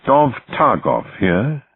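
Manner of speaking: slow, crisp Oxford English introduction; crisp Oxford accent. A narrator's reading. Naval officer introducing himself to prisoners, crisp Oxford accent. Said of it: "in a crisp Oxford accent".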